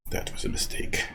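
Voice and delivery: breathy whispered aside; hushed repeat of the line. Real, not acted. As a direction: whisper it to yourself.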